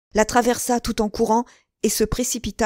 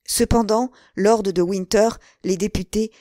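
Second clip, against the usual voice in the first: plain reading voice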